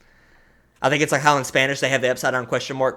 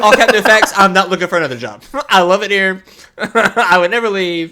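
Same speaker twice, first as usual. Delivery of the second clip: loud, high lines broken by laughter; laughing loudly; 7 semitones higher, 9 dB louder than usual. Real, not acted. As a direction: laugh through the joke about loving the job. Laughing through joking boss lines.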